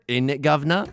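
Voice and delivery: gateway-phrase mock British; British accent. Shown as a demonstration. Streamer demonstrating a gateway word, stagey British accent. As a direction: slip into a stock British "governor" voice. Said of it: "You need a gateway word".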